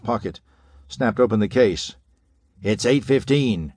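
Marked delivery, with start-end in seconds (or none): none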